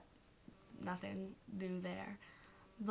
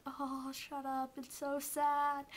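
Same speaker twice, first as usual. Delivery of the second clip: mock distress; 6 semitones higher, slower than usual. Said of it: "and I was like".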